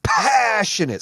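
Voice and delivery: high, loud shout; passionate shout. Shown as a demonstration. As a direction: belt the word from the chest. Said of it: "Say it with me".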